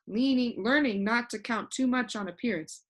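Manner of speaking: plain reading voice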